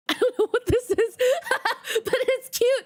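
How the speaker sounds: high and laughing, charmed; delighted laughter over a cute thing. Real, not acted. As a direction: giggle at something cute.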